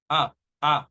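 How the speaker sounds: short isolated "ah, ah"; schwa sound. Shown as a demonstration. Teacher isolating the schwa for learners, short "ah". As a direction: give two brief neutral "ah" vowels. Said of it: "the schwa is the sound of ah".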